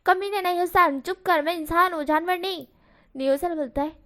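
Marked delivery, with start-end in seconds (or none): 0.0-2.7 higher, louder angry outburst; indignant shout at a teasing friend; angry cut-in. Acted. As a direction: snap back furiously, cutting him off. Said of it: "बीच में ही बोलता है".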